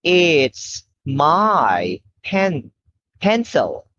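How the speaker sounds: very slow, clear model sentence. Shown as a demonstration. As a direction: draw each word out for the child. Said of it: "Okay, now you say".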